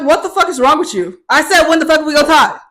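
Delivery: high, loud, indignant comeback; acted-out heated arguing; angry retort. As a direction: fire back at the roommate, exasperated. Acted quarrel with roommate.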